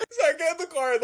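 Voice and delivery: very high laughter into speech; breaking into laughter; laughing. Real, not acted. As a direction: laugh helplessly. Laughing at situation.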